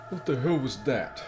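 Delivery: a quiet stunned gasp of a question; soft shocked reaction. Real, not acted. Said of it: "My reaction".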